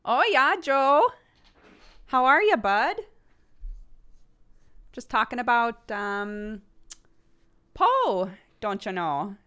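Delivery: bright Minnesota mom, all "oh yeah" and "don't you know"; Minnesota mom accent. Retold or imitated. Streamer slips into her Minnesota mom character, Minnesota accent. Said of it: "And I had to learn the Minnesotan accent".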